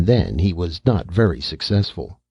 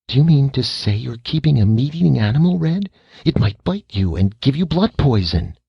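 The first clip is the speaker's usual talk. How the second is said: alarmed; 8 semitones higher than usual. Acted. Mother alarmed at meat-eating pet.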